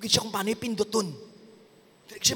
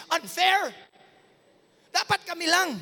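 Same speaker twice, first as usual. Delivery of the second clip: put-on high, complaining kid voice; whiny child; 11 semitones higher than usual. Kids protesting to their parent, high and whiny. Siblings complaining about fairness.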